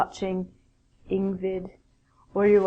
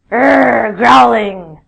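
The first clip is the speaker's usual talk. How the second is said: growling dog; 11 dB louder, much slower than usual. Pretend. Imitates growling dogs.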